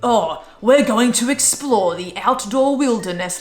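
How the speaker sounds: mock English presenter accent. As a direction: ham up a cheesy English nature presenter. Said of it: "then it has that accent".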